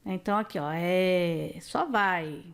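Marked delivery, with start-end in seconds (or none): 0.7-1.6 "é" held long with an open sound; open vowel. Shown as a demonstration. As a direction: hold an open "eh" on "é". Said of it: "Som aberto".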